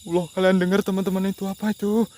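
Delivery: startled cry to the viewers about a sound; startled alarm. Real, not acted. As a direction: react as if a sound just startled you.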